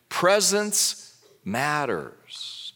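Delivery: very slow, each word weighed; emphatic slow point. Real, not acted. Pastor tells the congregation presence matters, very slowly. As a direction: land it word by word. Preacher drives home his point.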